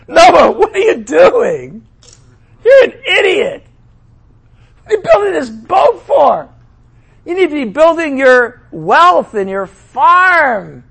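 high, loud taunting mocker; hammed-up scoffing crowd; mocking jeer. Mockers jeering at Noah.

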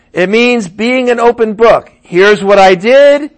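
ordinary talk, nothing marked

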